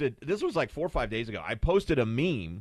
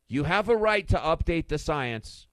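normal talking voice

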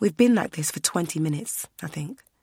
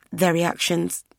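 plain reading voice

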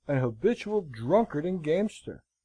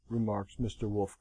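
usual speaking voice

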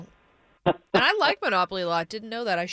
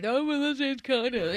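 higher-pitched mock frat-guy voice; stupid frat guy; 4 semitones higher than usual. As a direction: imitate a dumb frat guy. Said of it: "That's like a stupid frat guy".

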